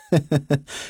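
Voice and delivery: acted sneering laugh; short bully's laugh; mocking villain chuckle. A narrator's reading. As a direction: chuckle softly at the victim's plea. Bully laughs at the prisoner's plea.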